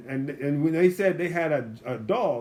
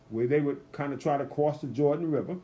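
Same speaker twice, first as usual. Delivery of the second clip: ordinary delivery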